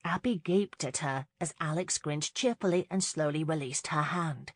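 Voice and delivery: plain reading voice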